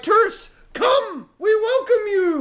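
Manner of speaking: high, pleading call to tourists; desperate welcome. Pretend. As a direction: beg the tourists to come back. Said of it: "they're gonna be like".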